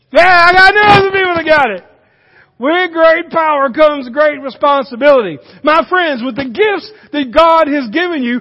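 loud, pounding pulpit delivery; full-force preaching. Acted. Preacher nailing the Spider-Man line for the congregation, loud preaching. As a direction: shout the line home to the crowd.

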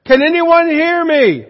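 high, loud cry for help from below; desperate scream. Retold or imitated. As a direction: yell up the cliff, panicking. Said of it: "So he began to scream".

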